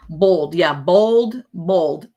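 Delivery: loud Texas "bold" for boiled, no oy sound; Texas pronunciation. Shown as a demonstration. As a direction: repeat Texas-style "bold" with a long o. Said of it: "here in Texas, they say bold".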